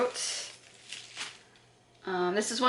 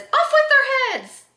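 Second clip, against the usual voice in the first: high, loud theatrical decree; mock royal command; 6 dB louder than usual. Vlogger reads out the Queen of Hearts blush slogan, hammed-up shout. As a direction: cry it out like a storybook queen.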